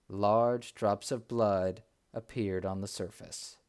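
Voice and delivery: ordinary delivery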